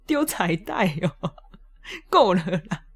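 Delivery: slow, laughing through the words; amused inner reply while laughing; laughing protest. Retold or imitated. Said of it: "我心想说".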